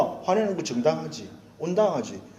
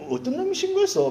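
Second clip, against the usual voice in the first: high, sharp indignant cry; indignant outburst; 13 semitones higher than usual. Retold or imitated. Pastor voicing his own angry thought about the fine, indignant. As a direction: spit out who reported me. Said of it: "막 그런 생각이 드는 거예요".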